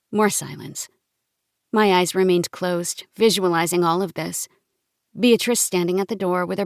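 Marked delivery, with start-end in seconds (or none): none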